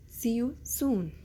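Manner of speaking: ordinary talk, nothing marked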